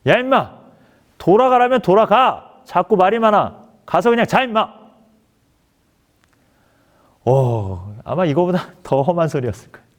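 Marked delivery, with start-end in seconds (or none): 0.1-4.9 loud, high-pitched officer's scolding; sharp raised-voice dismissal; angry shouting. Retold or imitated. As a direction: imitate an irritated commander yelling an order. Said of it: "큰 소리로 저에게 얘기하는 거예요".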